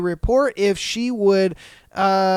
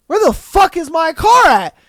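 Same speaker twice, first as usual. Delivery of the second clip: loud, high mock panic; hammed-up frantic yell; panicked shout; 7 semitones higher, 5 dB louder than usual. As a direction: yell like your car is gone. Acting out panic over car.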